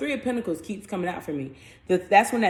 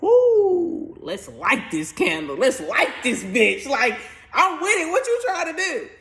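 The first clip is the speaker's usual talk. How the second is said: hyped-up Uranus; 6 semitones higher, slower than usual. Acted. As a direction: fire them up, ready to go. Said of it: "Uranus is like".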